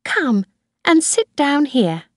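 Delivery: high-pitched grandmother, inviting; Mrs Harvey. A narrator's reading. Grandmother summons Kathy to sit.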